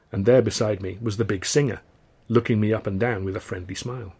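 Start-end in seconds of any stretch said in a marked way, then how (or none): none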